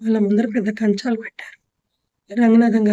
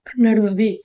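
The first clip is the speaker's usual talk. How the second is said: ordinary delivery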